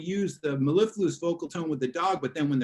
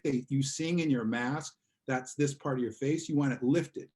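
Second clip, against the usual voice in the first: usual speaking voice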